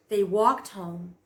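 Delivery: usual speaking voice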